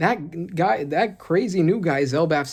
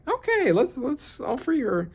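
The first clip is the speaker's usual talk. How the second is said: much higher goofy agreeing voice, slowing at the end; mock Ragnir; 10 semitones higher, slower than usual. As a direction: pitch way up for a silly giant's yes. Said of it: "I don't know if he's gonna be like".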